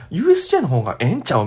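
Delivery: quick imitation of Kansai gals; fast mock Kansai dialect; Kansai accent. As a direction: do a brisk, questioning Kansai gal. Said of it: "すっごい関西弁が聞こえてくるんです".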